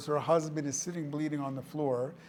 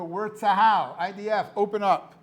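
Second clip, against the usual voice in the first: louder, higher demand to open up; calling through the door; 4 semitones higher, 7 dB louder than usual. Retold or imitated. Terrorists posing as soldiers calling through the safe-room door, loud.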